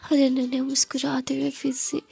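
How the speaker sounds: hushed, intimate story whisper; whispered narration. A narrator's reading. Narrator whispers the kiss scene, hushed and intimate. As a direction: breathe the romance softly to the listener.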